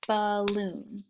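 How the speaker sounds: usual speaking voice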